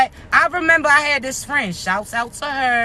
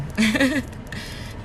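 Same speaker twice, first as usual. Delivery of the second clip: quick, chuckling SpongeBob-style laugh; 5 dB quieter than usual. Real, not acted. Streamer cracking up at their own remark, SpongeBob-like laugh. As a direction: let out a real SpongeBob-like laugh. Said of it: "hit that SpongeBob laugh too".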